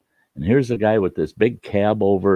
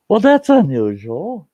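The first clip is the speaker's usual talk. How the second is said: higher, louder dry understatement; wry surprise; 11 semitones higher, 5 dB louder than usual. Retold or imitated. Walking past the truck, remarks on pheasant with wry surprise. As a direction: act mildly amazed at the pheasant. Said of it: "and I'm going".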